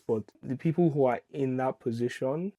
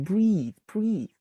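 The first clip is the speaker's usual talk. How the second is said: mock calming; 7 semitones higher than usual. Mock soothing imagined critic.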